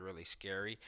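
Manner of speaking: ordinary talk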